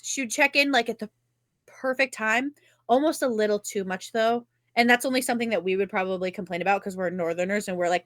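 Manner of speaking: ordinary talk, nothing marked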